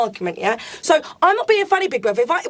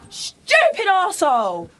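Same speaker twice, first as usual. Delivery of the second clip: ordinary delivery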